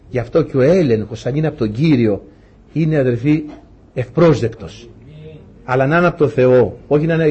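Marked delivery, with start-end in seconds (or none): none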